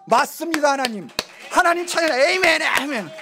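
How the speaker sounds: loud, high imitation of worshippers' praise; imitated high, loud amen outburst; noisy congregation. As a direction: mimic congregants shouting out mid-sermon. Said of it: "말씀 중에 시끄러워요".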